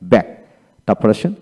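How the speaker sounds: ordinary delivery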